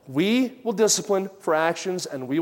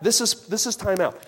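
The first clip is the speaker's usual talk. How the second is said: ordinary talk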